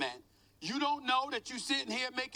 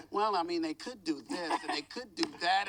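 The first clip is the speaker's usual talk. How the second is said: low, lukewarm mock of a hedging analyst; unenthusiastic analyst; 5 semitones lower than usual. Pundit mimicking a colleague's half-hearted trade pitch, lukewarm mockery. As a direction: imitate a noncommittal proposal, pitched down. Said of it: "with just a monotone voice".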